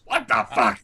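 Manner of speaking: squeaky outrage at the joke; incredulous. Real, not acted. Player incredulous.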